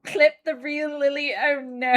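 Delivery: hammed-up put-on British accent; a deliberately posh-ish fake British accent, done for fun. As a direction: play the silly fake Brit for charity. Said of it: "the fake British accent, which is slightly put upon".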